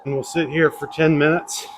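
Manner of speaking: talking over loud machine noise. Real, not acted. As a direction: speak up over the treadmill. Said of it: "This thing is super loud, so if you can't hear me".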